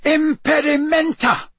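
loud, high shout of a curse; high, forceful last-ditch yell; desperate yelled spell. A narrator's reading. Second desperate spell at monster.

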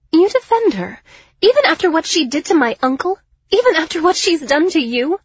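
high, loud, incredulous challenge; outraged. Acted. Liriana confronting Miles over his mother, outraged. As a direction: throw it at him in indignant disbelief.

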